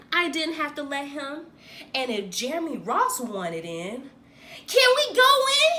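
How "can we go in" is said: high, loud hopeful question; eager kid asking. A narrator's reading. Boy asking his friend to enter the treehouse, high and eager. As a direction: beg to be let in. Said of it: "he asked".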